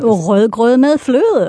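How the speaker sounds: Rød grød med fløde with the hot potato, slowly; a Danish guest shows off the tongue-twister, slow and throaty; Danish pronunciation. Shown as a demonstration. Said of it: "give it another".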